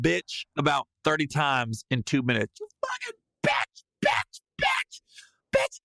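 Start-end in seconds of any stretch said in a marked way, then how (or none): 2.6-5.8 high screamed curses in separate bursts; furious cursing. Retold or imitated. Fan repeating curses in his hotel room, high screaming bursts. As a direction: reenact a tantrum, each word its own shriek.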